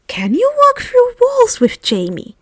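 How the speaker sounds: put-on high, eager girlish question; bright curious fairy asking eagerly; excited Luna. A narrator's reading.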